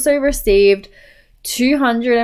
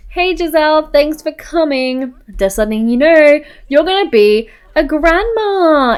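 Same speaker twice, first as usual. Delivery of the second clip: higher-pitched, playful big reveal; excited announcement; 7 semitones higher than usual. Pretend. Telling Giselle she'll be a grandma, excited. Acted announcement of pregnancy to mum.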